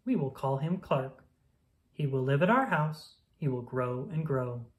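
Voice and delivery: plain reading voice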